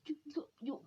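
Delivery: high, stammering mock amazement; amazed stutter. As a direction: stumble over the words in shock. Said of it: "he's stuttering 'cause he, he's amazed".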